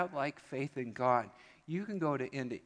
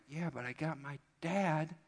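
lightly voiced proud kid; 7 semitones higher than usual. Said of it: "you're like".

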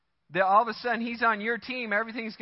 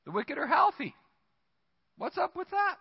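incredulous complaint; 9 semitones higher than usual. Retold or imitated. Acted psalmist's complaint.

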